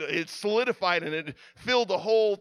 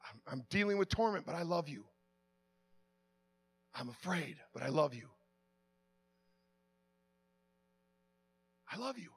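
fading prayer; 5 dB quieter than usual. Acted. Preacher voicing a struggling believer's prayer to the Lord, softening prayer. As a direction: pray it, letting each line fall quieter.

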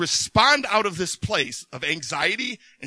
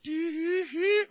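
mock meter squeal; 10 semitones higher, much slower than usual. Emotional scale reading out.

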